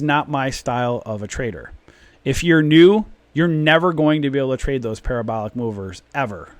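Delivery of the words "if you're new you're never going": loud, rising emphasis. Real, not acted. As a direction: call it out loud. Ranting emphasis.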